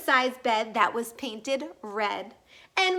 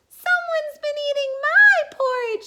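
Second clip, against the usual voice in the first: put-on squeaky baby bear; 13 semitones higher, 4 dB louder than usual. A narrator's reading. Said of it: "squeaked Baby Bear".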